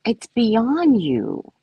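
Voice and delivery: drawn-out slow scolding; talking down gently to a man; slow patient explanation. Retold or imitated. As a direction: spell it out slowly for him. Lecturing him patiently.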